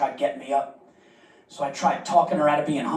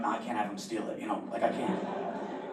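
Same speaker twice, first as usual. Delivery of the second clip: panicked mutter; 5 semitones lower, 10 dB quieter than usual. Retold or imitated. Panicked aside.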